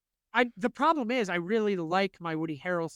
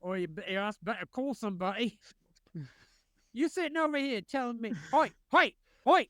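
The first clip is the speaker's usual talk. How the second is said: Cockney Statham voice; 4 semitones higher than usual. Retold or imitated. Friend does a hammed-up Cockney Statham at his friend. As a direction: bark it like a Cockney hard man. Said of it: "the speech in Cockney".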